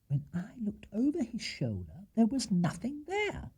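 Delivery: breathy hushed whisper. Acted. Wife whispering a secret to husband. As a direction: confide it in a whisper.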